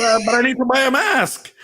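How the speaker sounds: high whiny plea. Retold or imitated. Guy pleading again.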